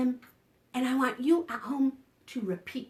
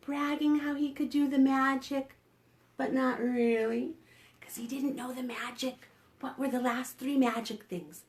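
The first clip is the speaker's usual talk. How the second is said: usual speaking voice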